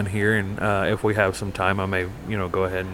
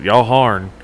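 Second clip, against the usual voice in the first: louder, clipped Southern "Y'all horn"; thick Southern accent; 6 dB louder than usual. Retold or imitated. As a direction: repeat it in broad Southern, loud. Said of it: "He said".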